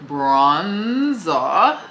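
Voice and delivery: Bronzer drawn out in two swooping halves; playful put-on way of saying Bronzer, both syllables lengthened; odd affected pronunciation. Shown as a demonstration. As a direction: stretch out Bronzer with a silly, affected lilt. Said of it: "don't even know why I'm talking like this".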